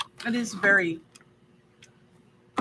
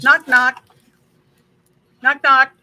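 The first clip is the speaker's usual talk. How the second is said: booming joke opener; loud knock knock; 5 semitones higher, 13 dB louder, slower than usual. Pretend. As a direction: call it out loudly. Calling out a joke loudly.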